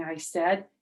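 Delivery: ordinary delivery, nothing marked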